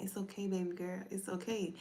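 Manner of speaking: a hint of the late uncle soothing a little girl; uncle's voice. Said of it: "In his voice".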